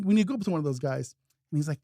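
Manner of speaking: usual speaking voice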